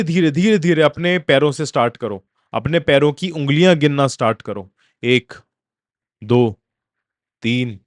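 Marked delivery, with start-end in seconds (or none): 5.0-7.8 measured, spaced-out count, pitched low; slow counting. Shown as a demonstration. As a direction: count one by one with long pauses. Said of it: "इस तरीके से एक एक उंगली को गिनो". (धीरे-धीरे, रुक-रुक कर गिनती)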